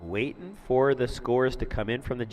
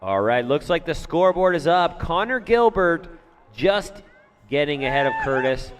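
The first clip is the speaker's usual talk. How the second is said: excited; 6 semitones higher, 7 dB louder than usual. Real, not acted. Scoreboard reveal.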